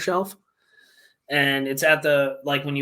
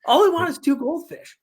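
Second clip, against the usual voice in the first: much higher, incredulous retold outburst; replaying his disbelief at the clerk, pitched way up; mock exasperation; 12 semitones higher than usual. Retelling his reaction to the pet store guy, high and incredulous. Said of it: "I'm like".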